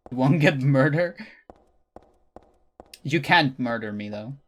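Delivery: ordinary delivery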